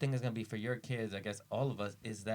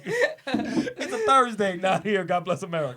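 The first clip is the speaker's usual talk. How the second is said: laughing mock announcement; 4 semitones higher, 8 dB louder, slower than usual. Riffing on the joke while laughing.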